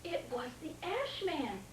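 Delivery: plain reading voice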